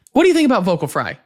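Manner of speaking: ordinary delivery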